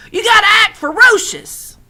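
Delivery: put-on high, loud old woman's voice; old woman urging. A narrator's reading. Old woman tells scarecrow to scare crows, high loud urging. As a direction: egg him on loudly.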